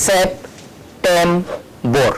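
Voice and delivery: very slow, drawn-out syllables; slow syllable demo. Shown as a demonstration. Teacher sounding out a word slowly for students. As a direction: stretch every part of the word. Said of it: "ওই সাউন্ডটা কিরকম হচ্ছে".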